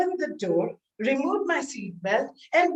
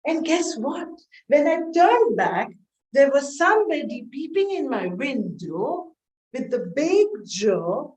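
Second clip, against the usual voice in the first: ordinary talk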